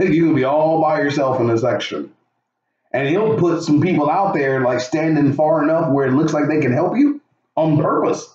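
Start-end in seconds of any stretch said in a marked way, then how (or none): none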